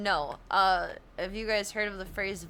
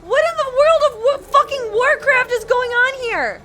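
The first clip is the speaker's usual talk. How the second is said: screamed very high and loud; full-throated panicked scream; frantic shout; 16 dB louder than usual. Real, not acted. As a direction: yell in total disbelief. Frantic during game fight.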